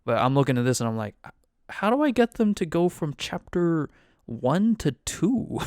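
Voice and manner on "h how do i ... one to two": high-pitched, baffled plea; mock exasperation. Game master puzzling over the book's chapters, mock exasperated. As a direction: plead for an answer, hammed-up and higher.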